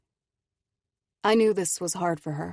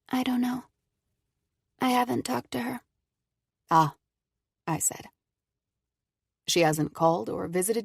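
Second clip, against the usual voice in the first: put-on young girl voice; 7 semitones higher than usual. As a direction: answer like a guarded child. Injured girl Cora answers.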